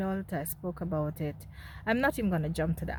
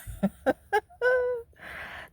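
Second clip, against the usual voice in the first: high laugh; 3 dB louder than usual. Real, not acted. Laughing at the claim.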